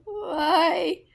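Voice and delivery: a high, loud, teary cry of disbelief; near tears. Retold or imitated. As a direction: squeak it out on the verge of tears. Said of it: "almost at the point of just bursting into tears".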